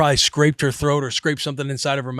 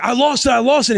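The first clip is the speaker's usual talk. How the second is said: high, frantic report of failure; retold panic; 10 semitones higher than usual. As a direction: imitate a rescuer losing hold in panic. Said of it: "Rue's panicking".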